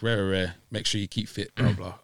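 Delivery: ordinary delivery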